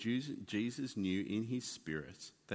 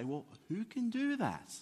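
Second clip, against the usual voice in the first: incredulous question; 11 semitones higher than usual. Retold or imitated. Scribes' incredulous reply.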